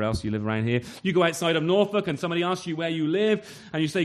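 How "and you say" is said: usual speaking voice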